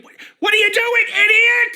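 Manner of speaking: high, loud scolding mom; exasperated mom. Retold or imitated. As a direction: yell like a fed-up mother. Mom's voice in head.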